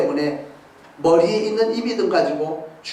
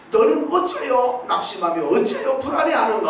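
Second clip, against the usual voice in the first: self-rebuke; 6 semitones higher than usual. Retold or imitated. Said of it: "자기 자신을 책망하는 거예요".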